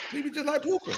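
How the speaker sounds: high-pitched, rising at the end. Pretend. Repeats line in high voice.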